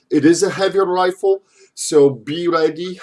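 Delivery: ordinary delivery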